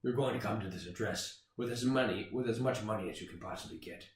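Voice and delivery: low, soft ransom threat; hushed movie-tough-guy menace; Liam Neeson. Acted. Kidnapper gives ransom instructions, low quiet menace. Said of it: "so he goes".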